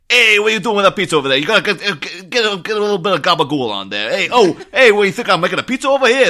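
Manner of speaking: put-on loud, high Italian-American pizza-guy accent; mock pizzeria accent. Friends aping the rival pizzerias film, loud Italian-American accent. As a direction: belt it out as a hammed-up Italian-American. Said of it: "doing that accent the whole time".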